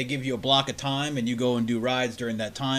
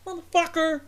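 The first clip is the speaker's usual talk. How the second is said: much higher mock yell; mock angry rant; 12 semitones higher than usual. Imagined passenger scolding French speakers, much higher mock rant. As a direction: snap at them in mock outrage. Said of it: "you'd be like".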